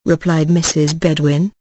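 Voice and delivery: ordinary delivery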